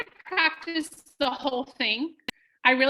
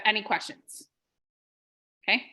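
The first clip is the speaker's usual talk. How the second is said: ordinary delivery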